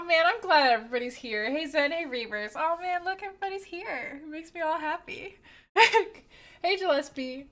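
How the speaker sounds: ordinary talk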